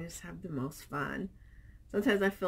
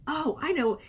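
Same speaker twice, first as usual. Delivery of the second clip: mock surprised; 9 semitones higher, 6 dB louder than usual. Retelling husband's reaction.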